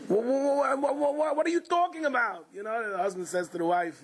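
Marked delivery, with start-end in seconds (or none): 0.1-2.5 high, stuttering disbelief; stammering shock. Acted. Patient sputtering at the Yerushalmis' joke, high stammering shock. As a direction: sputter like you can't believe your ears.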